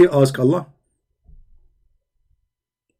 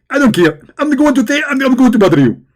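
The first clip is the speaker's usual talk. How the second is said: high, loud preachy mimic; Zakir Naik; 8 semitones higher, 3 dB louder than usual. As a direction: lecture like an excited preacher. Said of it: "Zakir Naik".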